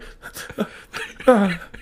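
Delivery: wheezy high cackle; high breathless laugh. Real, not acted. His own video reaction.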